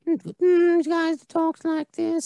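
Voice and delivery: put-on high, slow creepy old man; drawn-out, very high creepy voice. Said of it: "[name] sounding like the, uh, Herbert, the pervert in Family Guy".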